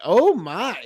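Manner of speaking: ordinary talk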